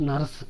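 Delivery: నర్స్ with the r fully pronounced; Telugu-style నర్స్, audible r; r sounded. Shown as a demonstration. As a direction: say నర్స్ as "narsu". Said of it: "నర్స్ అనకూడదు".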